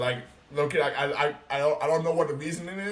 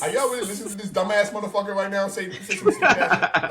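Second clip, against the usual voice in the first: louder, higher, laughing outrage; mock outraged call-out to the crowd; exasperated; 6 semitones higher, 5 dB louder than usual. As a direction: bellow at the room in disbelief. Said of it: "I'll be like".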